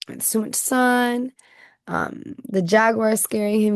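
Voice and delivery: ordinary talk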